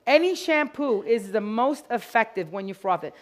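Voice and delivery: normal talking voice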